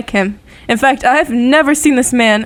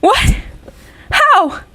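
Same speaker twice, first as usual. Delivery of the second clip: much higher, stunned and breathless questions; the floor drops out from under her; shocked disbelief; 8 semitones higher than usual. Acted. Wife told her husband is dead, in shocked disbelief.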